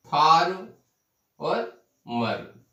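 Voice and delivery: "फार" and "मर" set apart with clear pauses; in separate pieces. Shown as a demonstration. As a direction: slowly isolate each syllable, "फार" then "मर". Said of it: "टुकड़ों में बोला जाता है". ("फार" और "मर" को अलग-अलग टुकड़ों में)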